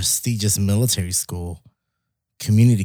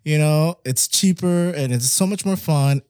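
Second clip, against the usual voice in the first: gushing excitement; 6 semitones higher, 3 dB louder than usual. Retold or imitated. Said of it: "I was like".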